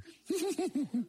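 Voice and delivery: very high squealing giggle; high girlish giggle. Acted. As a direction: giggle even harder. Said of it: "they begin to giggle even more".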